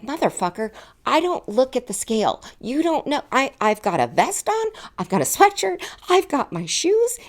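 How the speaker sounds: furious inner monologue, high and loud. Retold or imitated. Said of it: "in my head, I'm like".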